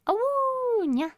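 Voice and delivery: very high howled awoo then nya; wolf voice. Shown as a demonstration. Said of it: "You just say nya after those?".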